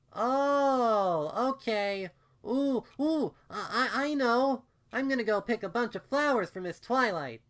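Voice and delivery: put-on high, loud excited child's voice; excited little filly. A narrator's reading. Filly deciding to pick flowers for Twilight, high and excited. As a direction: burst with a sudden happy idea.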